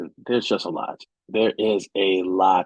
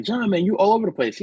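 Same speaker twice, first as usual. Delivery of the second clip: higher, quicker imitation of people greeting him; imitated acquaintance; 9 semitones higher, faster than usual. People who see him out at events, imitated high and quick. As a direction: mimic a friend's remark, pitched up and fast. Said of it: "when people see me, like".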